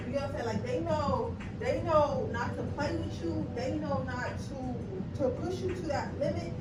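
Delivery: normal talking voice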